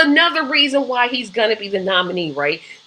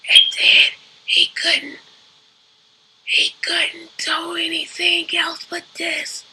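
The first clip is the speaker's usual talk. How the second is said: slow, whispered, barely audible mumble; mock the weak voice until it almost disappears; whispery Biden mockery; imitating Joe Biden; 6 semitones higher than usual. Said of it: "And you could barely hear him".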